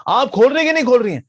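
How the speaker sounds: fast loud high demand; angry shout. Acted. Jaimini shouting at the girl through the door. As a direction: yell it at her, rushed.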